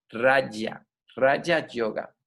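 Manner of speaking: "Raya" with a Spanish y sound. Shown as a demonstration. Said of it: "se pronuncia como una Y".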